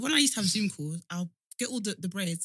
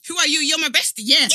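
high, loud comic indignation; loud indignant challenge; 7 semitones higher, 11 dB louder than usual. Real, not acted. Challenging her best friend to be honest, loudly indignant. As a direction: fire it back at your bestie.

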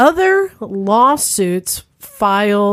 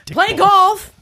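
indignant; 11 semitones higher than usual. Retold or imitated. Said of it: "he says".

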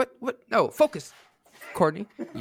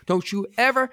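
mock Valley Girl threat; 9 semitones higher, 3 dB louder than usual. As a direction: start a catty Valley Girl warning. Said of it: "Valley Girl voice".

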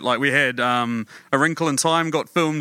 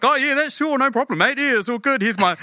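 much higher, breezy imitation of Taika with a laugh in the middle; Taika's easy reply; 8 semitones higher than usual. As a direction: toss off the offer like it's nothing, voice pitched way up. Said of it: "And he was just like".

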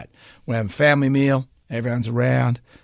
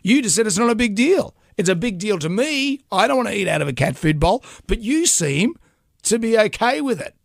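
high, indignant protest, pitch much higher; indignant retort; 8 semitones higher than usual. Retold or imitated. Husband protesting to his wife, high and indignant. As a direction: squeak up in outraged self-defence. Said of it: "I said".

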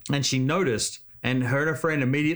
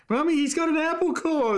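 put-on much higher childlike tattling voice; little kid. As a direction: squeal it like a kid pointing. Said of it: "Little kids are like".

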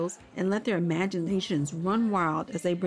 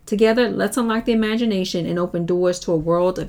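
louder, punchy announcer pitch over music; promo read; 7 dB louder than usual. Acted. Host promoting the podcast to parents, loud upbeat announcer read. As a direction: sell the podcast to listeners.

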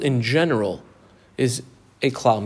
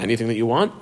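ordinary talk

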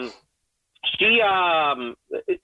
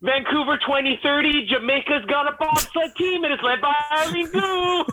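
mock announcer; 9 semitones higher than usual. Mock announcer hyping Eileen Gu.

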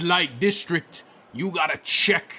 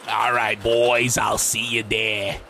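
low, slow hooker farewell; Big Ange; 8 semitones lower than usual. Acted. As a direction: sign off deep and sultry. Voiced figure Big Ange.

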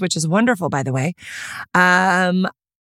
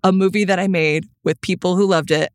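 ordinary delivery

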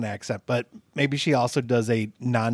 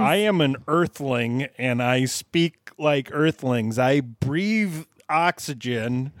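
slow, stilted mock alien; over-careful words of a pretend earthling; alien posing as human; slower than usual. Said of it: "He sounded like an alien".

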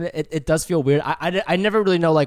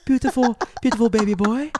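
mock mirror ad voice; 8 semitones higher than usual. Mirror-ad role play.

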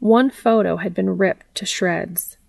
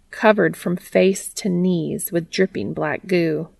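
usual speaking voice

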